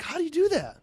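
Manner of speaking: very high, incredulous retold question; incredulous protest. Driver questions the official's second suspension, incredulous.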